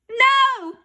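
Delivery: high, loud shout. Acted. Boy shouting against the vows. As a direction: shout with all your might. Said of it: "I shouted as loud as I could".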